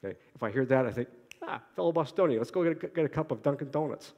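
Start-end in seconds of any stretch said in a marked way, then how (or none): 1.4-4.1 put-on Boston accent. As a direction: greet a fellow Bostonian in broad Boston speech. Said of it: "in my native Boston accent".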